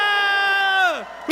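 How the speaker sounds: long, high cathartic scream; sustained yell; loud scream. Real, not acted. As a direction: scream it all out at full volume. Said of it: "scream as loud as we can".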